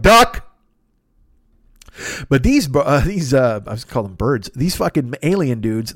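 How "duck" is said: urgent duck. Pretend. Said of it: "you're like".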